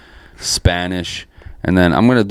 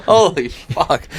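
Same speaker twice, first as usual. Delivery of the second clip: drawn-out disbelief; slow shocked holy fuck; stunned; 9 semitones higher, much slower than usual. Real, not acted. Shocked reaction.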